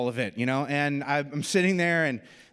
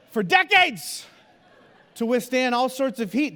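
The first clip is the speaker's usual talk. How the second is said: laughing; 8 semitones higher, 4 dB louder than usual. Real, not acted. Joking exaggeration, laugh heard.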